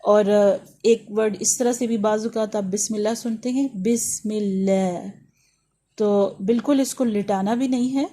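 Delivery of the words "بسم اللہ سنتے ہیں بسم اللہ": بسم اللہ stretched slow and long; drawn-out reading style. Shown as a demonstration. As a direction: draw out بسم اللہ slowly. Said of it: "یہ بھی ایک، آ، آ، پڑھنے کا سٹائل ہے".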